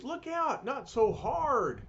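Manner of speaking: high, slowed alarmed cry; alarmed old man. Acted. Old man yelping at Ben to go easy, high cry. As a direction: cry out as your car breaks.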